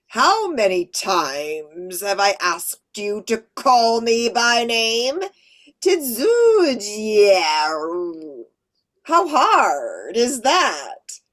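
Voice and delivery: high, loud and slow alien overlord; a slow, booming metallic villain; echoing metallic voice. Shown as a demonstration. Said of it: "she said in her echoing metallic voice".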